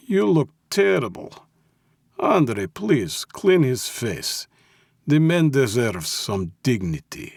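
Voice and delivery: put-on clipped Russian accent. A narrator's reading. Mob boss orders captive's face cleaned, Russian accent. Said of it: "said Malensky in his clipped Russian accent".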